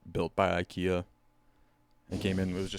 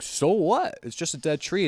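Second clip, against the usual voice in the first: higher, louder scoffing mock; dismissive retort; 8 semitones higher, 5 dB louder than usual. [name] brushing off the angry cult, higher scoffing tone. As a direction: brush them off like it's nothing.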